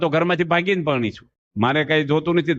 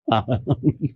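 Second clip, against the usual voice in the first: laughter; 5 semitones lower than usual. Real, not acted. Audience laughs at joke.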